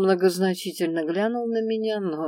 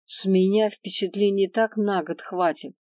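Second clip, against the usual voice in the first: ordinary delivery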